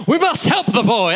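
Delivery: high, loud put-on dog voice; hammed-up rescuer's proclamation; heroic rescue dogs. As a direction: play a dog coming to the rescue. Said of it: "they're like".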